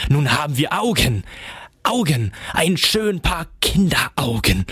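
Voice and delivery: ordinary delivery, nothing marked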